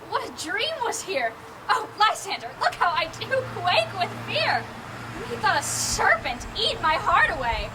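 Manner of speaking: ordinary talk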